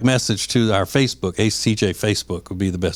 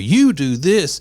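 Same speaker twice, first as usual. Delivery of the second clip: accusatory; 5 semitones higher, slower than usual. Retold or imitated. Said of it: "it's accusatory".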